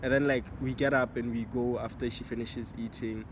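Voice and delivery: usual speaking voice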